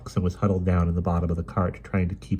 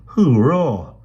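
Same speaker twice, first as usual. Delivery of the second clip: loud, high burst of delight; joyful cheer; 7 semitones higher, 9 dB louder than usual. Acted. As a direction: cheer at finding the key. Key found at last.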